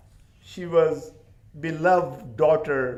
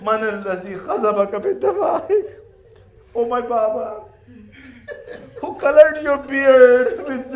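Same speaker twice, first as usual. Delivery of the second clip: wailing lament; 6 semitones higher, 9 dB louder than usual. Acted. Sakina laments over her father's head, wailing. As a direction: wail it like a grieving child. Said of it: "She says".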